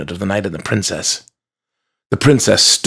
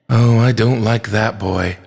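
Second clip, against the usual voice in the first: usual speaking voice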